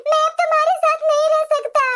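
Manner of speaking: put-on a distressed young bride explaining the divorce papers; tearful daughter-in-law. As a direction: plead with mother-in-law, holding back sobs.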